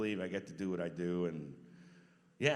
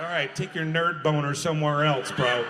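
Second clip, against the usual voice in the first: louder robot voice, shooing him off; gruff robot brush-off; 4 semitones higher, 13 dB louder than usual. Retold or imitated. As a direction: wave off the fan in character, loud. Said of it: "I'm like".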